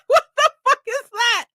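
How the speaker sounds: high, stunned what the fuck; mock disbelief at the wall of heat; shocked exclamation. Recalling first step into Texas humidity, shocked.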